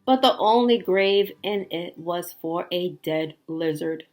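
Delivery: gentle, wistful storybook reading; story narration. A narrator's reading. Reading the ending to a child, tender storytelling. Reads picture book aloud.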